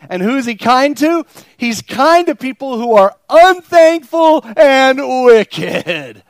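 full-throated preaching; preaching at full force. Acted. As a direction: hammer it to the congregation. Preacher at peak intensity.

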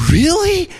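put-on high-pitched child's question; doubting little boy. Little boy asking grandpa, high childlike surprise.

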